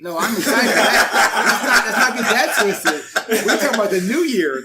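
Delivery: loud laughing protest. Real, not acted. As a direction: laugh while insisting. Laughing defensive.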